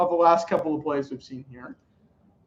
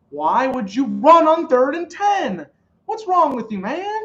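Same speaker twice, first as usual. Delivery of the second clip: loud, high-pitched disbelief at the play call; exasperated; 9 semitones higher, 6 dB louder than usual. Real, not acted. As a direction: fume at the coaches, voice climbing. Fan fuming at a bad call.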